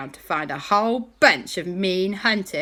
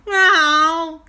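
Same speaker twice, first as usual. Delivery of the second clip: cheeky refusal; 11 semitones higher, 9 dB louder than usual. Acted. Boys refuse to come down.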